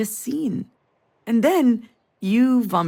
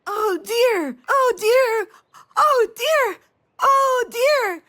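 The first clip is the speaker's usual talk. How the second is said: put-on high, loud, excited kid squeal; excited child cry; 5 dB louder, slower than usual. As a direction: squeal it like a thrilled toddler, much higher and louder. Child pointing excitedly at deer.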